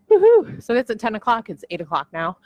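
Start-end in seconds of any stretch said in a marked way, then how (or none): 0.1-0.6 much higher and louder whoop; excited cheer. Real, not acted. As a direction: cheer for yourself. Cheering about her meeting.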